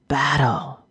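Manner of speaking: faint rasping creature voice; rasping orc. A narrator's reading. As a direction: rasp out the word. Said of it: "the orc rasped".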